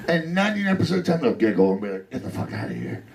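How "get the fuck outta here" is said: whispered, giggly get lost; hushed amused dismissal from a cop; amused cop brush-off. Retold or imitated. Cop giggling him off, whispered and amused.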